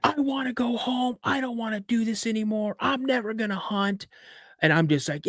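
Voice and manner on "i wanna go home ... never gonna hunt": high, strained wail, held back in volume; retold screaming. Seven-year-old screams for dad from deer stand, retold wail. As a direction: imitate a seven-year-old's panicked screaming. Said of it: "I just start screaming".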